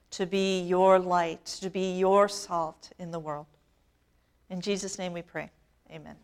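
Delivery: prayer. Acted. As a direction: pray quietly before the congregation. Leads the closing prayer.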